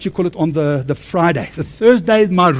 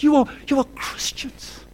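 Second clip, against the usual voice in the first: whispered aside; 8 semitones higher, 3 dB quieter than usual. Real, not acted. Preacher whispering to the congregation, hushed. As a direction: whisper it to the church.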